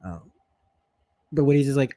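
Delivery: ordinary talk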